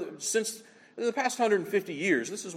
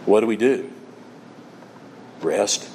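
hushed solemn preaching; 9 semitones lower than usual. Acted. Preacher consoling believers, low and grave. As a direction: let each short line sink in.

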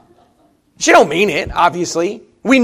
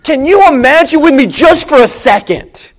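loud, high-pitched pulpit fervor; impassioned preaching; 6 semitones higher, 5 dB louder than usual. Real, not acted. Preacher testifying of answered prayer, impassioned preaching. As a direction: press each question on the whole congregation.

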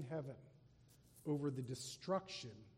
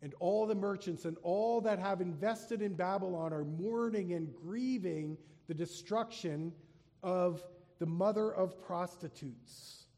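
raised, solemn reading voice; scripture reading; 4 semitones higher, 7 dB louder than usual. Acted. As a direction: proclaim the passage to the congregation. Reads scripture aloud.

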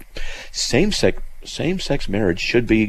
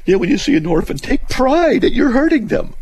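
mock crying; 3 dB louder than usual. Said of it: "I'm gonna start crying here".

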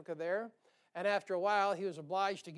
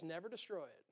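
hushed; 2 semitones lower, 13 dB quieter than usual. Acted. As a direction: drop to a near whisper on Never destroy it. Repeats the line, first hushed.

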